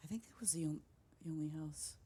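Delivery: soft voiceless whisper; whispered aside. Real, not acted. Streamer half to herself about a house, whispering. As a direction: whisper it to yourself.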